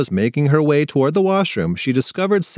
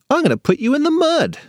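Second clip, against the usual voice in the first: lightly voiced boy. A narrator's reading. Older Sebby's catchphrase.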